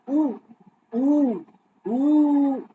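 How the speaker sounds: short clean ऊ repeated as a timing unit; ऊ held for exactly one measure; one-mātrā ऊ. Shown as a demonstration. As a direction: say ऊ briefly, once per beat. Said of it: "ऊ उच्चारण जितनी देर में बोला जाता है".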